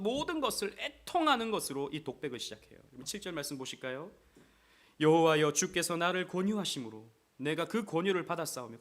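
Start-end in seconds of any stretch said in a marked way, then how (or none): 5.0-8.8 loud, lifted reading of the verse; fervent scripture reading. Acted. Preacher reading scripture to the church, fervent and loud. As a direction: proclaim the verse to the congregation.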